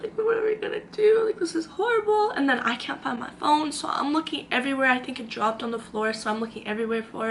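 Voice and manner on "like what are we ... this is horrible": high-pitched panicky whine; mock distress. Fretting over friend's broken teeth, high distress. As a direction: act out helpless dismay, high.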